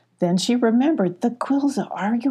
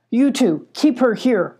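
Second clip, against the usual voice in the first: firm, raised command; ordering; 5 semitones higher, 4 dB louder than usual. A narrator's reading. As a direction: order the guards firmly. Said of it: "ordered Scar".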